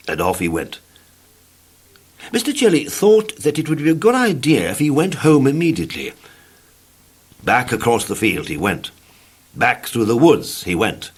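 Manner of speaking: plain reading voice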